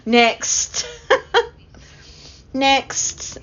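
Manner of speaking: laughing. Real, not acted. Laughing at her own story.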